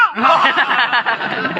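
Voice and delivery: delighted burst of laughter. Real, not acted. Laugh after child's shout.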